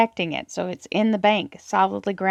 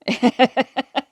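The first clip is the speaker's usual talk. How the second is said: short, louder chuckle; 4 semitones higher, 6 dB louder than usual. Real, not acted. Reader amused after begging to differ, short chuckle. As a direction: chuckle at your own remark, pitched up.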